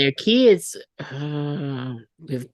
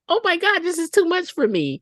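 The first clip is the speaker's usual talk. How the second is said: mock overwhelmed; 13 semitones higher than usual. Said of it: "And then, the".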